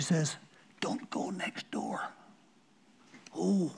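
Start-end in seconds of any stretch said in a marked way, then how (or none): 0.8-2.0 hushed unvoiced whisper, slow; whispered warning. Neighbour lady whispering a warning about next door. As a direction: breathe the warning secretly.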